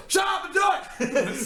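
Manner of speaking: high, slow, loud mock yell; mock shout. Mock yell at his wife about fetching water. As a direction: bark the order with a laugh. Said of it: "I was like".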